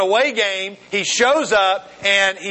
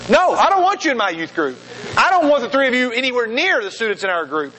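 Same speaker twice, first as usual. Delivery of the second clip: higher-pitched, emphatic mock indignation; retold anger; 4 semitones higher than usual. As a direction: lay down an indignant ban, without shouting. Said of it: "I found myself getting so angry".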